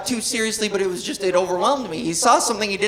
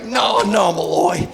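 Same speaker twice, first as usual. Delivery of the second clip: soft, low, sorrowful boss voice; the chief sadly takes his cop's badge, quiet and tender; gentle heartbroken chief; 4 semitones lower, 5 dB quieter than usual. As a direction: break it to him gently, softly and low. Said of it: "Chief's like".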